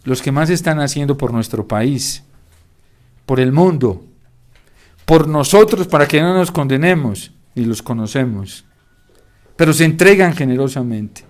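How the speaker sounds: usual speaking voice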